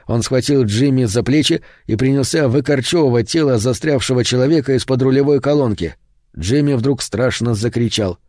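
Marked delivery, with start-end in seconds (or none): none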